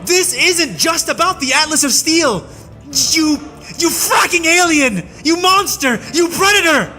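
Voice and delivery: high, furious railing, rising to a shout; a prisoner straining at his bonds, raging; railing in fury. A narrator's reading. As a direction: hurl each insult harder. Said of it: "he railed".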